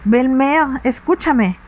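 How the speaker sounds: a light, gentle plea to her stepmother; pleading. A narrator's reading. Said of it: "suplicó Teresa".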